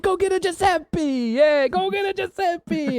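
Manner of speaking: high, slow mock Italian holler; yelling Italian accent. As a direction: drag it out like an Italian painter calling across a site. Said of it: "two Italian accents yelling at each other".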